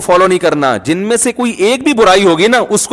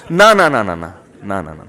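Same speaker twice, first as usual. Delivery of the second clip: mock refusal; 9 semitones lower than usual. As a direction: refuse in a sinking, muttered string. Acting out refusal to block.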